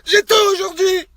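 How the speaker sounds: high-pitched, worked-up outburst; very angry. Real, not acted. Man telling his Snapchat followers he is furious, angry outburst. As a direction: let anger climb into a high cry. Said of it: "quelqu'un qui est très en colère".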